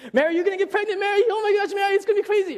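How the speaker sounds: squealing, over-the-top angel spilling the news; frantic overexcited angel. Retold or imitated. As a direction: blurt the news like a panicking messenger. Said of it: "Angels don't come like".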